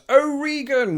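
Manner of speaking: hammed-up bellow of Oregon; mock yell. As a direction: belt "Oregon" like a passionate cry. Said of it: "yelling from the top of their lungs".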